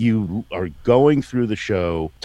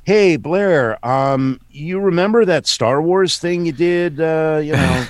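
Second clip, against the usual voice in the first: high-pitched, hesitant agent on the phone; higher voice, feeling out the client; imitated agent; 8 semitones higher, 3 dB louder than usual. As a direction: lift the voice high as the agent tentatively breaking news. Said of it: "it's my agent, and she says".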